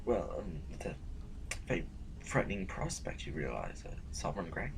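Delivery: frightened, whispered admission; hushed fear. Acted. Burgess confessing his dread to the Sovereign, hushed whisper. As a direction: barely voice the fear.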